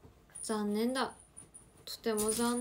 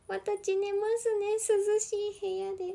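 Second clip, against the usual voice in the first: mock prim quote; 8 semitones higher than usual. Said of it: "って".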